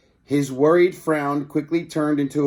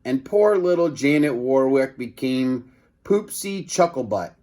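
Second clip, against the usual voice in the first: ordinary delivery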